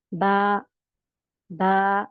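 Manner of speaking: बा said light and fine, not heavy; light thin ba. Shown as a demonstration. Teacher demonstrating a fine, light बा. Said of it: "ये बहुत बारीक letter है".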